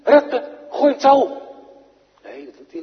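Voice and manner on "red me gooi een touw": high, loud panicked shout for help; cry for help. Retold or imitated. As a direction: yell it as a drowning man to his friends. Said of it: "Roept hij naar de discipelen".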